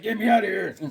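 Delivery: hammed-up trapped hero; chained captive. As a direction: play a captive demanding release. Voices a chained-up Conan.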